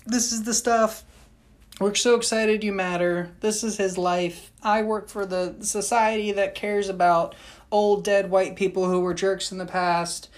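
bright high greeter voice, cheery to the end; chirpy guide impression. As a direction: sell it like an over-eager hostess. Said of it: "and she's like".

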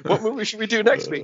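a hint of a pleased ventriloquist; lightly voiced boss thanking his dummy; smug puppet master. Host thanking his puppet, lightly smug. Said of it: "And I'll be like".

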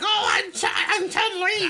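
put-on high, slow shouted old lady. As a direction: screech it high and slow like a granny. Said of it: "I accidentally did an old lady".

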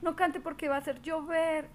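high, hammed-up plea to stop the singing; mock pleading. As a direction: beg her comically not to sing. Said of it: "decían".

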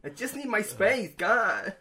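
high, exasperated sulky whine; whiny mock huff. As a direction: sigh it out like a fed-up partner. Mock clingy partner demanding space.

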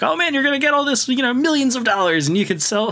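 high, quick, hyped-up coaxing; mock sales pitch. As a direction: play a con man talking someone into it. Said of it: "he kinda tricks him into".

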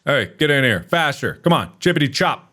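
usual speaking voice

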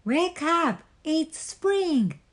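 high, lively wake-up call; mild storybook shout; excited shout. A narrator's reading. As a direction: call out high to rouse a sleeper. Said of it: "shouted Frog".

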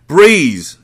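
loud, high call; yelled name. A narrator's reading. Zaire searching every room for Breeze, yelling. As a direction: shout her name through the rooms. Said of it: "Zaire yelled".

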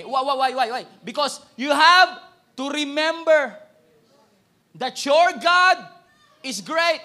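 loud, high, driving sermon climax; full-force preaching. Acted. Preacher rousing the congregation about God's greatness. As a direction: shout it out to the crowd.

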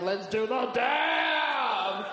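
long drawn-out, high party holler; gung-ho holler. Retold or imitated. Woman agreeing to a dab at a party, gung-ho holler. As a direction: whoop it out with every word stretched. Acts out drunken enthusiasm at party.

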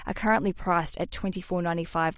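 ordinary talk, nothing marked

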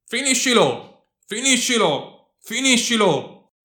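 high, drawn-out cry urging the kill; urgent yell. A narrator's reading. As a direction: shout it high and long, falling away. (grido acuto e incalzante)

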